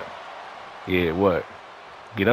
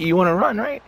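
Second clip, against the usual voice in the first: taunting up high; high mocking taunt; 12 semitones higher, 3 dB louder than usual. Real, not acted. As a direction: sneer it up high.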